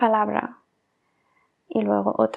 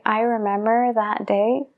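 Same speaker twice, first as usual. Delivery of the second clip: "I remember that day" said slowly, each word apart; 2 semitones lower than usual. Shown as a demonstration. Teacher shows how an over-slow phrase sounds odd. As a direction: drag it out word by word. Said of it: "si yo dijera lentamente".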